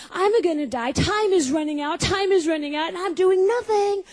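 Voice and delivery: high, anxious rant rising in pitch; railing teen. Retold or imitated. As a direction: pace and rail at the world as a panicked teen. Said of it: "And I'm railing".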